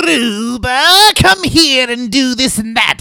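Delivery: high, loud, drawn-out calling; Scrooba routine. Retold or imitated. Performer re-enacting his old Scrooba bit, loud high calling. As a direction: call out theatrically, stretching the name. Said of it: "when I did that whole".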